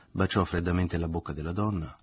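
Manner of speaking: usual speaking voice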